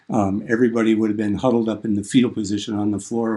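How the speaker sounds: normal talking voice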